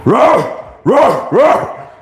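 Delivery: playful high mock roar at the dogs. Owner mimics her barking dogs with a playful roar. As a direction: ham up a silly roar like the dogs.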